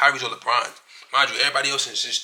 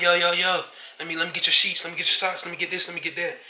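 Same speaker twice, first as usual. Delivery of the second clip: eager begging inmates; 5 semitones higher than usual. Retold or imitated. Inmates begging the departing narrator, eager and higher. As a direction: mimic inmates clamoring for his stuff. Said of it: "everybody like".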